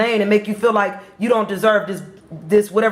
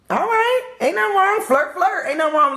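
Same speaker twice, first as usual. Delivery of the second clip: pitched way up, teasing and giddy; playful teasing; 9 semitones higher than usual. Acted. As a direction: egg them on to flirt, playfully high. Teasing water signs about flirting.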